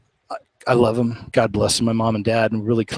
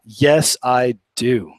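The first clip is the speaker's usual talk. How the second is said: ordinary delivery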